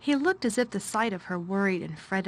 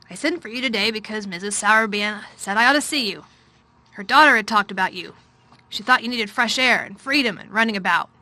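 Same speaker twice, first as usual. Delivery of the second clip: louder, weary master of the house; a wretched man explaining himself; troubled guardian's voice; 7 dB louder than usual. A narrator's reading. Guardian explaining himself to Mary, loud and weary. Craven's long speech.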